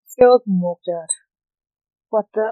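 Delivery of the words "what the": startled cry. Acted. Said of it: "I cried".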